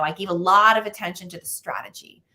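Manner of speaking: normal talking voice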